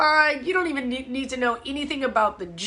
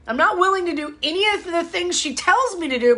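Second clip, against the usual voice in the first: mock demanding client; 6 semitones higher, faster than usual. Client's voice acted out.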